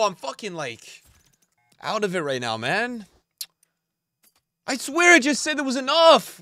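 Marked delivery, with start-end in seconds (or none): 4.7-6.4 frustrated outburst. Real, not acted. Squad build fails again.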